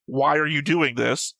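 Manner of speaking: usual speaking voice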